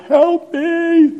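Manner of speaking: high, loud cry for help across the room; urgent call for help. Retold or imitated. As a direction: call out to Maddie and Edna for help. Calling colleagues for help.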